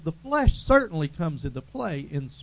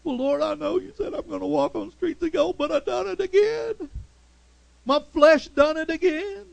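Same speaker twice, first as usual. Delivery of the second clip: confessing believer; 9 semitones higher, 4 dB louder than usual. Retold or imitated.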